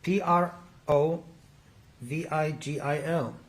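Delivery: P R O V I G I L spelled slowly, letter by letter. Real, not acted. Lawyer answering the witness's request to repeat a drug name, spelling P R O V I G I L out. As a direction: spell P R O V I G I L in two slow groups. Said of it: "Could you pronounce that again".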